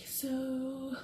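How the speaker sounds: high, hesitant mock client voice; acted sheepish confession, pitched high; ashamed woman client. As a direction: play an embarrassed woman haltingly asking for advice. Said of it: "this woman is just like".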